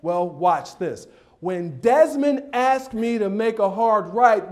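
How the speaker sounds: ordinary delivery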